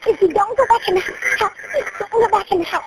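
ordinary talk, nothing marked

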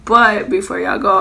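ordinary talk

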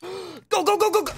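high, rising go go go; will the wheel on, high and tense; frantic urging. Real, not acted. Streamer watching the roll stop, frantic high urging.

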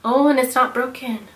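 ordinary talk, nothing marked

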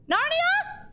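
very high questioning call. Pretend. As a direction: call into the hidden passage, rising high. Calls into a hidden passage.

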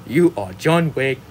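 usual speaking voice

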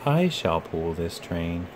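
slow, soft musing to himself; Thomas musing. Acted. Thomas dreaming of pulling Henry's train, slow and soft. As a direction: savour the thought slowly, lightly voiced. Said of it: "he thought".

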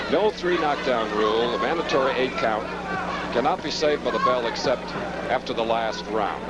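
usual speaking voice

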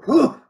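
high, sharp whew of breath knocked out; imitated winded gasp. The champ hit in the solar plexus, a high winded whew. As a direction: squeeze the air out high like a punch to the gut. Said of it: "you could hear it go".

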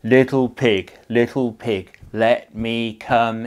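loud, slow wolf call. Acted. Wolf calling to the first pig, loud and slow. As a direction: drag it out like a wolf at the door. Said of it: "and he said".

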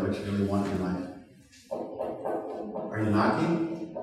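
hushed preaching. Acted. Preacher quietly challenging the congregation. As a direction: lean in and press them quietly. Quiet intense sermon climax.

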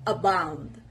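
low, careful "Abound", stressed on "bound"; teacher's model "Abound", weight on the second syllable; second-part stress. Shown as a demonstration. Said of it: "The stress is on the second part".

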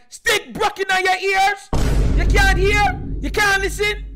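loud, high Jamaican scolding; furious Jamaican mom. Retold or imitated. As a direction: shout it like a mother who's had enough. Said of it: "You get her mad".

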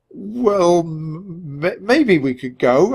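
nervous, halting stammer on m; stammering reluctantly to his sister; stuttering. Acted. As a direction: stumble over the words, unsure. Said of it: "I stuttered".

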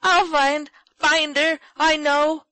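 high, loud, distraught insistence; frantic high-pitched outcry; screamed. A narrator's reading. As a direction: yell it in bursts like an upset child. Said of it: "screamed Joel, who could barely see ... he had cried so".

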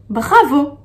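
ordinary delivery

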